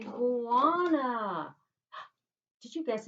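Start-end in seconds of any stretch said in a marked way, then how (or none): 0.0-1.5 "iguana" stretched very slowly and louder; big reveal. Shown as a demonstration. Teacher to class, drawn-out answer reveal. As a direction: unveil the answer with a flourish.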